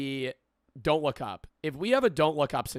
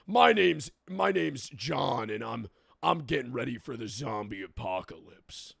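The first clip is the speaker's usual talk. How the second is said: brutish Southern man. Retold or imitated. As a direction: play a gruff Southern prepper bragging about zombies. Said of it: "a brutish Southern male".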